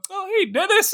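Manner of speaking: high, chirpy falsetto hello; falsetto greeting. Retold or imitated. Prisoner greeting the guard in a high voice. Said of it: "he's like".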